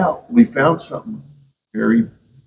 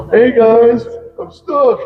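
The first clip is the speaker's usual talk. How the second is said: mock call for help; 9 semitones higher, 4 dB louder, slower than usual. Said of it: "What's he do?".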